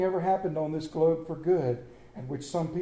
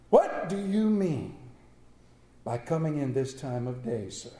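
feigned growl; 5 semitones lower than usual. Acted. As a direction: put on the gruff voice he used to have. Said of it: "growled Scrooge".

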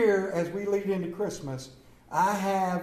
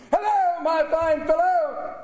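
much higher and louder, stretched out; calling out; 9 semitones higher, 3 dB louder than usual. Acted. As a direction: hail the boy from the window. Calling down to a boy.

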